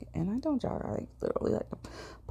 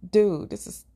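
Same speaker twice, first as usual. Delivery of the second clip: high, louder exasperated rant; 8 semitones higher, 7 dB louder than usual. Real, not acted. Recounting the weird store visit, loud exasperated venting. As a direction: vent the frustration, loud. Venting agitation at strange encounter.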